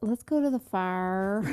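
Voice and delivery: imitated slow Southern drawl; Southern twang. Parent imitating child's accent picked up down south, Southern drawl. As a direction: stretch it out in a little Southern twang. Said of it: "A little twang. Southern drawl".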